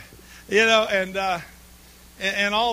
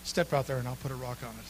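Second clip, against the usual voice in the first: a lightly lowered, quiet voice of God; 6 semitones lower, 7 dB quieter than usual. Acted. God answering the bargaining man, a touch lower and calm. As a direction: answer calmly as God from heaven. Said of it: "the voice came from heaven".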